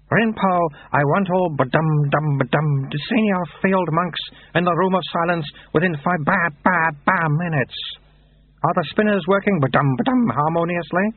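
put-on high-pitched abbot, dignity broken by baby babble; babbling baby abbot. Abbot declining Lutze's offer, babbling like a baby. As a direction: mix solemn orders with infant noises.

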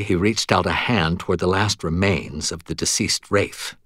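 plain reading voice

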